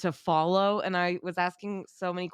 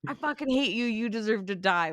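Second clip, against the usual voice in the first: higher-pitched, half-hearted hate rant at normal volume; mock intense hatred; 5 semitones higher than usual. Podcaster imitating someone too passionate about a hot take, mock intense. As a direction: play at overblown hatred without really yelling. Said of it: "that's really intense".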